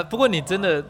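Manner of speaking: ordinary delivery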